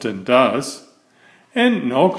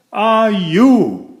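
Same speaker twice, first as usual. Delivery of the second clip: high, louder spluttering question; spluttered; 7 semitones higher, 4 dB louder than usual. A narrator's reading. Fussy man glares at the stranger, high spluttering. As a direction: choke out the question in horror. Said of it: "spluttered Mister Fussy".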